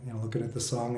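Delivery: ordinary delivery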